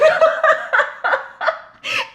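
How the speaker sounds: loud high burst of laughter; big laugh. Real, not acted. Laughing hard at the memory of breakdancers. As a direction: crack up at the breakdancing. Laughing at absurd club scene.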